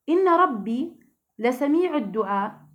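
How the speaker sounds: slow, chanted recitation of "إن ربي لسميع الدعاء"; Quran recitation. Shown as a demonstration. Teacher reciting the verse to her students, slow and chanted. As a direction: intone the verse slowly, tajweed style.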